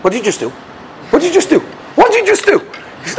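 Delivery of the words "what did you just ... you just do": repeated, climbing much higher each time; rising disbelief. Retold or imitated. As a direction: build from shock to outrage. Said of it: "I'm like".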